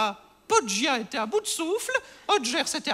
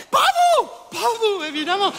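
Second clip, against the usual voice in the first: indignant outburst; 3 semitones higher, 5 dB louder than usual. Acted. Landlady reassuring her visitor he is no gangster, indignant outburst. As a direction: cry out in sudden protest. Blurts protest about the landlady's guests.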